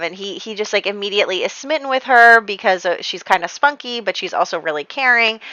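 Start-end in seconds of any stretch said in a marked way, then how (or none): none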